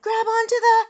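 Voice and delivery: high shout, cut short; desperate shout. A narrator's reading. Neil calls to falling Eric, desperate high shout. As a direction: shout a lifeline to someone falling. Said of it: "Neil shouted".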